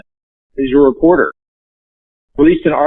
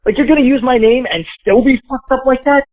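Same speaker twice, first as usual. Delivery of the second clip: higher, incredulous outburst; retold disbelief; 9 semitones higher than usual. Reacting to his name used in the operation, higher incredulous retort. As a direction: act out your own disbelief, raised pitch. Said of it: "And I'm like".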